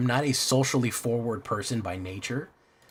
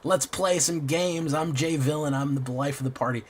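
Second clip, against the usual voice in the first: outgoing party guy; 8 semitones higher than usual. Retold or imitated. Mocking the convention-goer he is not, hyped party voice. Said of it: "I wouldn't be like".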